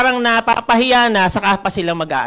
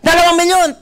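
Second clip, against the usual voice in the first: loud, higher hyped cry of two million; practice announcement shout; 9 semitones higher, 3 dB louder than usual. Pretend. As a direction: announce it like a rally emcee. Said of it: "Imagine mo ganyan".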